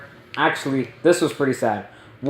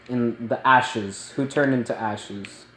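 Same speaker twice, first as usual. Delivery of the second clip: ordinary delivery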